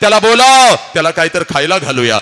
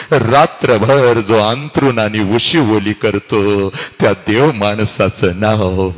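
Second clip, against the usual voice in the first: much lower and slower, each word weighed; preacher slowly naming the father as a god-like man; solemn climax; 9 semitones lower, slower than usual. Acted. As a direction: let it land slowly and heavily.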